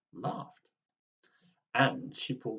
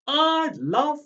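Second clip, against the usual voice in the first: much higher, louder, slow and gleeful; yelled; 12 semitones higher, 11 dB louder, much slower than usual. Acted. Granny racing to the fairground, loud high gleeful yell. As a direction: yell it with delight. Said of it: "she yelled".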